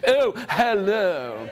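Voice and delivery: slow, drawn-out tiger hello; tiger's greeting. Shown as a demonstration. Storyteller has children copy the tiger's slow greeting. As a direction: stretch out the hello in character. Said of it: "Can you do that with me?".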